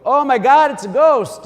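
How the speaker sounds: loud, high panicked shriek; terrified cry. Retold or imitated. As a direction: scream at a ghost on the water. Disciples see figure on water.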